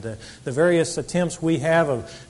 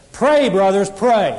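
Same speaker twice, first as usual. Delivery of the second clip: emphatic "Pray", loud and raised, framing the address; stress on Pray; 3 semitones higher, 6 dB louder, slower than usual. Acted. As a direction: Pray punched louder and higher at both ends. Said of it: "to put emphasis on the verb".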